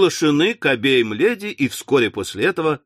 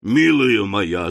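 placating; 7 semitones lower, slower than usual. Acted. Husband placating wife.

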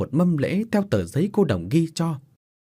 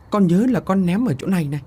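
giọng trẻ con; 7 semitones higher, 3 dB louder than usual. Acted. Đứa trẻ chỉ chỗ cho bố.

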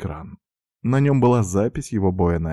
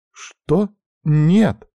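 much higher, loud shocked cry; shocked refusal; 10 semitones higher, 6 dB louder than usual. A narrator's reading. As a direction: recoil at the news. Sister shocked by his plan.

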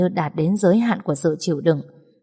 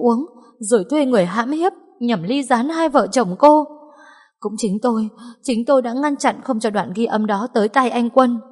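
frantic confession; 6 semitones higher than usual. Acted. Trang confessing in terror.

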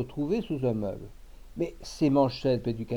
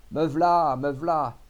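louder, hurried answer on the run; calling back; 3 semitones higher, 7 dB louder, slower than usual. Acted. Butcher running from the café to his father-in-law, calling back. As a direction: call back while running over. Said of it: "accourut, disant".

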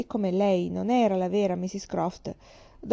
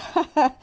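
a short, high burst of hearty laughter; 9 semitones higher, 7 dB louder than usual. A narrator's reading. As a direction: laugh heartily, like an old sailor amused. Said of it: "E ridendo di cuore". (risata di cuore)

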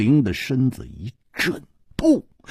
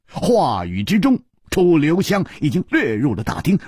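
usual speaking voice

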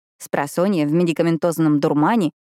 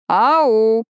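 a loud teasing call; 4 semitones higher, 5 dB louder than usual. Acted. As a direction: call out to get her attention. Calling out to ignoring Pippa.

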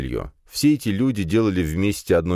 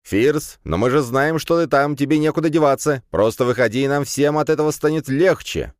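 high, raised, insistent voice; calling through a door; 9 semitones higher, 3 dB louder than usual. A narrator's reading. Classmate urging Firs to come out, insistent calling. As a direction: coax loudly from the other side.

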